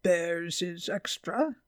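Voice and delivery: slow, grumpy taxi driver; gruff, drawn-out grumble; growled. A narrator's reading. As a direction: growl it slowly. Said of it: "he growled".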